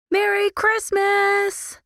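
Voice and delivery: high, slow joyful yell. Acted. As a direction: shout it across the room. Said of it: "Lily yelled".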